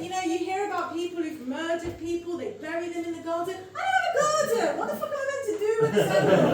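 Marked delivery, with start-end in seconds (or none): 3.8-5.8 panicked cry. Real, not acted. Panicked outburst.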